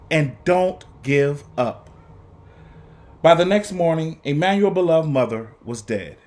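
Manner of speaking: put-on expressive read-aloud for young students; lively, paced story reading with dramatic pauses; storybook narration. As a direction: read it like a teacher sharing a picture book with the class. Teacher reads picture book aloud.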